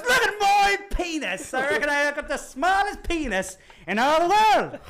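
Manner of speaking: high, loud, drawn-out mock bellow; hammed-up outraged boast; furious drunk dwarf. Third dwarf outdoing the others, hammed-up furious holler. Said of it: "He is furious".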